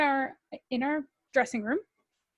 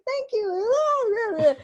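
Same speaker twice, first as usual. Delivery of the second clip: high, louder imitation of gushing; retold delighted thanks; 11 semitones higher, 6 dB louder than usual. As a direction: play up being flattered.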